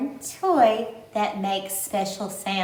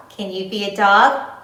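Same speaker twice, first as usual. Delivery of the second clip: usual speaking voice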